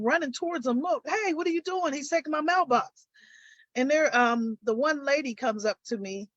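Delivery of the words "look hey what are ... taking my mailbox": retelling a yell at the neighbor, voiced high but restrained; retold yelling. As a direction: call out in alarm, pitch up, volume held back. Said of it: "yelling at him".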